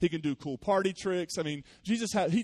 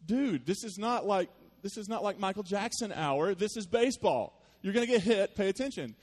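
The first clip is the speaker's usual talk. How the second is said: higher, louder mock scolding of a dancing kid; exasperated coach telling off a daydreaming kid; 6 semitones higher, 3 dB louder than usual. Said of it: "And I'm like".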